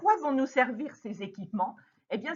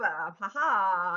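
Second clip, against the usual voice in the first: ordinary talk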